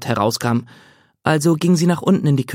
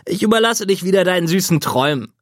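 higher, teasing send-off; joking brother; 5 semitones higher than usual. Acted. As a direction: wink at her as you leave. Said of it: "scherzte Scott".

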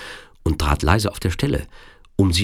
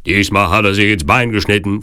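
loud, slightly raised report; Turkish henchman; 4 semitones higher, 6 dB louder than usual. A narrator's reading. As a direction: call out the news in a loud henchman voice. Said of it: "fuhr der Türke fort". (laute Meldung des Türken)

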